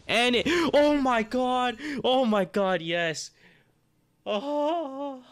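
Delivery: high, loud, stretched screams of joy; ecstatic shriek. Real, not acted. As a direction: scream with disbelieving delight at the lucky pull. Finally pulling the five star.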